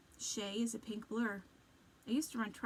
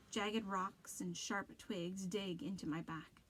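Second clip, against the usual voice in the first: ordinary delivery, nothing marked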